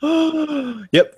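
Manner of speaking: excited gasp. Real, not acted. Excited game approval.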